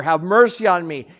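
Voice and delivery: the loudest cry for mercy. Shown as a demonstration. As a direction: shout the plea out to God.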